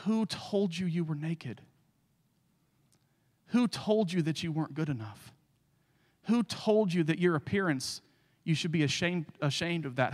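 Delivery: soft, grieving questions, lightly voiced; tearful God. God asking Adam after the fall, softly heartbroken. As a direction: ask gently, heartbroken, barely above a hush. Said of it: "in tears he said".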